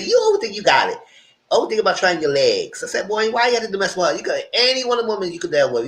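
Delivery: mock scolding. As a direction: tell him off as if he were here. Teller scolds a character in retelling.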